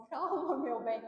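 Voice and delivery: calm husband. Retold or imitated. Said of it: "Ele falava assim".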